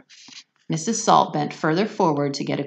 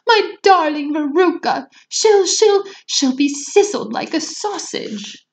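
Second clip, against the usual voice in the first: high loud horrified wail; wailing mother; 12 semitones higher, 6 dB louder than usual. Acted. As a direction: wail it in horror. Mother wails in horror.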